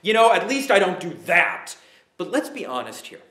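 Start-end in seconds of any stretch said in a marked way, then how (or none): 0.0-1.8 loud, mock self-justifying protest; self-excusing sinful nature. Preacher voicing your sinful nature, mock self-excusing. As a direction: excuse yourself loudly, in mockery. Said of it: "Your sinful nature will constantly go".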